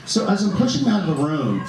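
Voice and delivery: usual speaking voice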